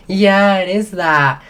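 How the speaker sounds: brightly confirming in a retold chat; eager confirmation. Acting out excited recognition at bar.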